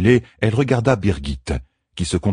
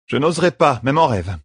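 higher, wry promise; dry champion; 8 semitones higher than usual. A narrator's reading. Champion replies wryly to the midwife. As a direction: answer with dry irony. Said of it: "dit la championne".

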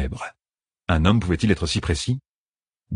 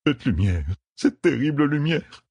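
high, strained lament; anguished raving; 8 semitones higher than usual. A narrator's reading. Blinded lord haunted by what he saw, anguished raving. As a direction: recoil from a horror, voice climbing high. Maimed lord raves about the light.

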